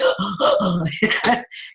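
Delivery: sharp gasp of realisation; retold gasp. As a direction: gasp as it suddenly clicks. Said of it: "And I was just like".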